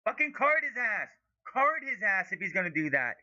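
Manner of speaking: high, forceful yell for a card; angry demand. Real, not acted. Fan yelling for the referee to book a player, high angry demand. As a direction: bark the demand at the ref.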